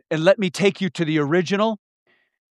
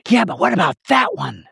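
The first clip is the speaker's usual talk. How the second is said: accusing inner voice. Pretend. The attack comes in, accusing.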